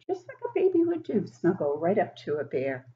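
ordinary delivery, nothing marked

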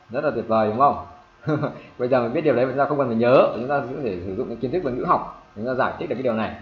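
usual speaking voice

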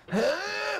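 sharp gasp. Real, not acted. Near fall in game.

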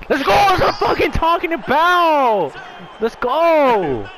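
loud, high goal celebration; excited yell. Real, not acted. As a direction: scream it after scoring.

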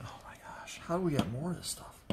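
excited whisper. Real, not acted. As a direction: hush it out in awe. Whispered excitement over find.

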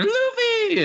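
very high, drawn-out call; Momonosuke. Acted. Child calling out to Luffy, very high cry. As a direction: squeal the name high, stretching the end. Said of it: "Momonosuke is going".

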